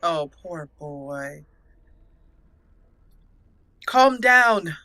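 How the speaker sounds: usual speaking voice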